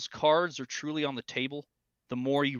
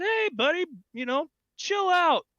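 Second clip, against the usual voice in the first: high, louder call to settle him; calling out to calm him; 13 semitones higher, 3 dB louder than usual. Retold or imitated. Speaker calling across to the raging old man, loud and high. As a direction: raise the voice to talk him down.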